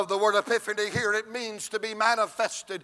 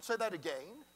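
prim educated lady; 12 dB quieter than usual. Retold or imitated. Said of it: "educated people don't say again".

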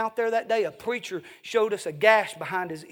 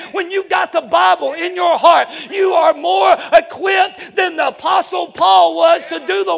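loud, raised, hard-driven sermon delivery; full-force preaching; 10 semitones higher, 14 dB louder, slower than usual. Acted. Preacher rallying the congregation behind the Bible, full-force preaching. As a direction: preach it at a shout, building to a drawn-out finish.

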